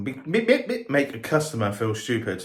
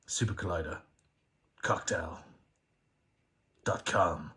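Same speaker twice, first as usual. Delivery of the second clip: announcer voice; 6 semitones lower, 8 dB quieter than usual. Pretend. Announcing a fake website.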